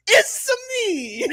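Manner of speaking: it's-a me like Mario in an Italian accent. Shown as a demonstration. Shows the right way to start an Italian impression. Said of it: "you start with".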